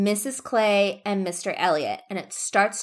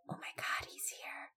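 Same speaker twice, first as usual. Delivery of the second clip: barely audible whisper; imitated hushed aside to oneself; stunned whisper; 22 dB quieter than usual. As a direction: whisper it as if hiding. Anne secretly spots Wentworth.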